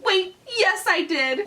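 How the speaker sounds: high, exclaimed correction; sudden admission. Acted. Storybook brother admitting he screamed, high blurted correction. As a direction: blurt it out high, catching yourself.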